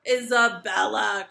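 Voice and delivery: very loud, drawn-out name; booming, furious summons; enraged cry. A narrator's reading. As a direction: cry the name in a royal rage. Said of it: "cried the king".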